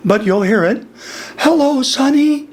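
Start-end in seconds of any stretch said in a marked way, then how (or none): 1.4-2.5 high-pitched hello; higher demonstration voice, projected; next gear up. Shown as a demonstration. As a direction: lift the voice up into a higher register. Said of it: "the next gear is broken in my voice".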